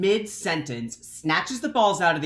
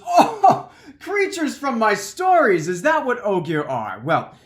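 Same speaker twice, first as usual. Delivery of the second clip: making fun; 2 semitones higher, 4 dB louder than usual. Retold or imitated. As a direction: tease them with exaggerated awe. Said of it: "I'm totally making fun of him".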